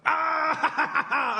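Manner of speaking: sudden high laughing yelp; startled yelp. Retold or imitated. Retelling a footballer's yelp to a podcast co-host, high and laughing. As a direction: mimic a yelp of caught-out surprise. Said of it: "What the fuck was that?".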